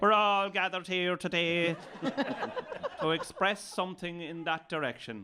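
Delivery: high, loud West of Ireland priest; priest accent. Shown as a demonstration. Priest addressing the gathered congregation, West of Ireland priest accent. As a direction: intone it loudly in a rural Irish priest's accent. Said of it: "there's a priest accent".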